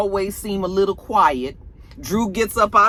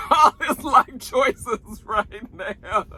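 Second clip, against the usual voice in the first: laughing; 4 semitones higher, much slower than usual. Real, not acted. Laughing at Drew's fate.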